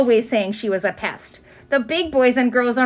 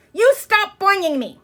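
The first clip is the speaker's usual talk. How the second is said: high, indignant complaint; upset girl; 9 semitones higher than usual. Acted. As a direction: scold the boinging child. Susan upset.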